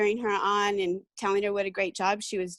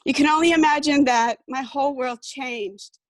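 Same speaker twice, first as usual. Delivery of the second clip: emotional; 4 semitones higher, 5 dB louder than usual. Real, not acted. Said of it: "I'm gonna get emotional".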